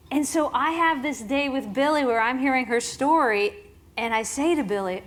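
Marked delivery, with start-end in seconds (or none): none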